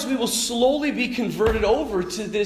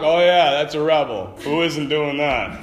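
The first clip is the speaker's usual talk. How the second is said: sarcastic; 5 semitones lower than usual. Retold or imitated. Sarcastic reply to kids.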